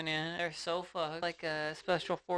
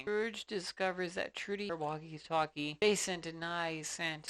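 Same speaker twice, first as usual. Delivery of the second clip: usual speaking voice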